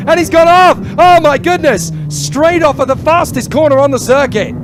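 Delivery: loud, high, sudden burst; excited shout. Real, not acted. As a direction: yell the crash call live. Driver crashes off track live.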